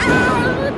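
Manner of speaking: erschrockener Schrei. Acted. Startled cry at lightning.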